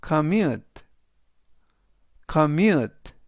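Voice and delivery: commute, said carefully as com-MUTE; teacher modelling commute; model pronunciation. Shown as a demonstration. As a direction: say commute clearly for repetition. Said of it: "commute라고 발음하시면 되겠습니다".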